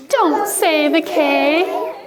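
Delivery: drawn-out, high, fretful chant; worried ghost warning. Shown as a demonstration. Class copying the worried ghost with teacher, slow high warning. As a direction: stretch each word in a high, pleading voice. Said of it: "Do it just like he did".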